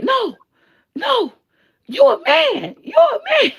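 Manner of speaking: put-on squeaky high little-kid shout; little boy. Small boy insisting to the woman. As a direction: shout it like a blunt six-year-old.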